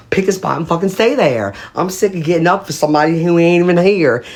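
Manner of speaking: exasperated retelling. Retold or imitated. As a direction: act out being sick of it. Acts out quarrel over invisible Peter.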